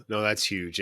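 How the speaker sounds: ordinary delivery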